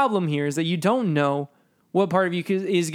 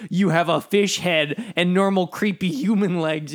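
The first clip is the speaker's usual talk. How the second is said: usual speaking voice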